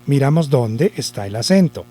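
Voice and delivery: usual speaking voice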